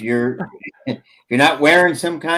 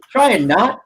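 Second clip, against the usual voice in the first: ordinary talk, nothing marked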